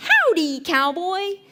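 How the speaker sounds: high, hammed-up American howdy; attempted American accent. Shown as a demonstration. South African translating a greeting, mock American accent. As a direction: call it out like an American cowboy. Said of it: "my best attempt at, uh, American accent".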